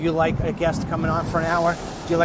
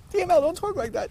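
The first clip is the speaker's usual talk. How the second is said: much higher put-on voice telling him off; mock scolding voice. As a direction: pretend nagging voice, pitched way up. Scolding himself in another voice.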